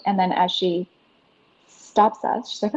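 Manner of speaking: normal talking voice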